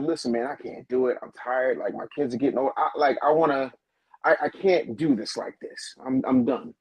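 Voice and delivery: low, worn-out voice of giving up; retold weary plea. Wrestler telling his boss he can't do it, weary. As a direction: imitate a tired man admitting he's finished.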